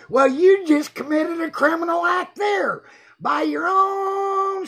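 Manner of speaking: high outcry, drawing out OWN; indignant accusation. Real, not acted. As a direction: throw the accusation back, high and stretched. Turns accusation back on the chatter.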